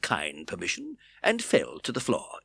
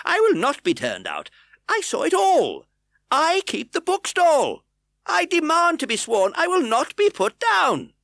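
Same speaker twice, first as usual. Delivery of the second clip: high, loud defiant shout; 8 dB louder than usual. A narrator's reading. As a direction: stand your ground shouting. Bookseller defiant.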